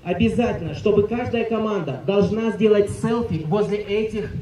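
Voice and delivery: ordinary talk, nothing marked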